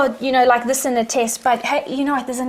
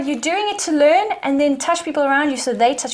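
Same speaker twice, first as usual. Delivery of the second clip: normal talking voice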